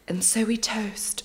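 soft, breathy British whisper quoting her; whispered toast in a posh British accent. Retold or imitated. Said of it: "I don't know why she's British all of a sudden".

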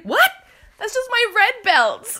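high, loud mock indignation; mock indignant. A boyfriend defending his belts, high and loud in mock indignation. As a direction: play him baffled and high-pitched. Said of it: "And he's like".

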